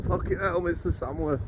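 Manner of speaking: a hint of a lad wincing through pain; prisoner in pain. Cuffed prisoner to the officer during catheter, mild pained cry.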